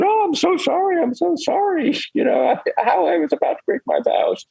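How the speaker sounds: high, fretful apologetic disciple voice; mortified Ananda. Retold or imitated. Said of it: "is terribly mortified. Says".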